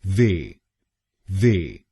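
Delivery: वे as a long "vay"; syllable demo. Shown as a demonstration. Teacher shows the changed third syllable वे. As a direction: open वे into a clear "vay". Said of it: "हम बोलेंगे".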